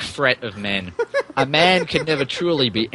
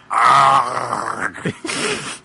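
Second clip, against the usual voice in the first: helpless laughter; 2 semitones lower than usual. Real, not acted. Laughs at absurd fanfic.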